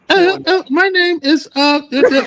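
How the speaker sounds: put-on woman with a German accent, pitched very high; mock a German accent in a high voice; high-pitched imitation of a German-accented woman. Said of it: "she got a German accent".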